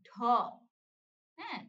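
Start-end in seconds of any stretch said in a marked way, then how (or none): 0.1-0.1 "ঢ" with a strong puff of breath; aspirated dh. Shown as a demonstration. Teacher models "ঢ" with a strong breath. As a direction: push air out on "ঢ". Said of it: "ঢ উচ্চারণ করলে বাতাস কি বেশি".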